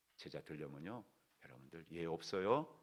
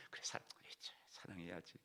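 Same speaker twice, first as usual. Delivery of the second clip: whispered aside; 3 semitones lower, 14 dB quieter than usual. Acted. Husband's inner reply to the Lord.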